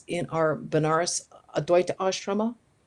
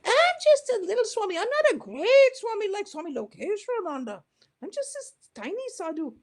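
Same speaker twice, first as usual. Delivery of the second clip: much higher, small self-effacing voice; humble little monk. Retold or imitated. Humble swami answering the narrator's question, high and small. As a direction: shrink yourself into a tiny humble monk. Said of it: "He said".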